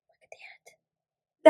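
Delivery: hushed, slow whisper of wonder; awed whisper. Real, not acted. Whispering to viewers in awe at her nail. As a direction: breathe it out in awe.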